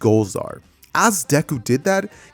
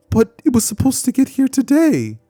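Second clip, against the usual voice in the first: put-on childish disappointed whine; 11 semitones higher than usual. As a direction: let the letdown squeak out like a kid. Deku disappointed, package delayed.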